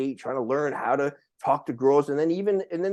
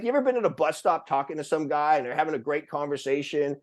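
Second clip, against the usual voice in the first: ordinary talk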